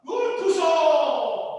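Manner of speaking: loud, slow, pompous mock; drawn-out hammed-up boast; mocking elite slogan. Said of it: "don't know or care or think about".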